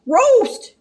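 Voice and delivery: Scooby shout. Acted. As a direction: scream it in a cartoon panic. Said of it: "shouted Scooby".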